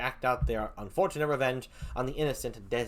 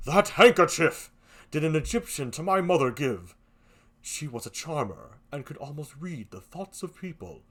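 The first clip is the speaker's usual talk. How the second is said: Othello. Acted. Othello voiced in play reading.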